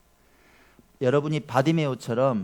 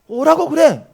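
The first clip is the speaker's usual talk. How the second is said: commanding call; 12 semitones higher, 10 dB louder, slower than usual. Pretend. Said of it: "주님이 부르셨어요".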